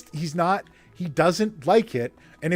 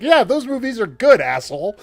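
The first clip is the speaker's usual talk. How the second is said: loud, higher-pitched comeback, dropping on the insult; exasperated retort; 5 semitones higher, 6 dB louder than usual. Retold or imitated. Host answers the snobbish studio people, exasperated retort. Angry retort to the mock executive.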